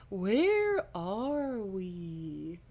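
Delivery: hammed-up dazed voice, extremely slow; very drawn-out, swooping dazed question; exaggerated daze. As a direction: stretch every word right out, woozily. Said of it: "he asks in a dazed voice".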